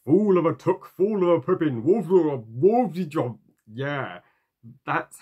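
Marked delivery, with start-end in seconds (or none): 0.1-3.4 high, loud, garbled mock wizard yell; mocking Gandalf. Reviewer mocks the animated Gandalf's line, high and shouted. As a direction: shriek it like a cartoon wizard gone mad. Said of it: "when he's like".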